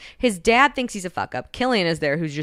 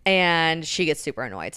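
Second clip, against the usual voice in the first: ordinary delivery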